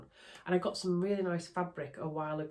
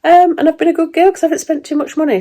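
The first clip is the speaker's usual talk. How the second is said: high cutesy voice; 12 semitones higher, 21 dB louder than usual. Pretend. Jokey self praise.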